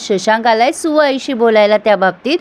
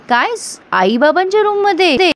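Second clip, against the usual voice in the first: surprised; 7 semitones higher than usual. Retold or imitated. Sumi shocked by room news.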